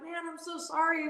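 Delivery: high, softened retold apology; pleading apology. Racer apologising to crew chief after the scare, pleading.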